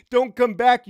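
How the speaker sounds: heated, real banishing shout; angry dismissal. Real, not acted. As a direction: punch it out at full voice. Tells off people who unfollowed him.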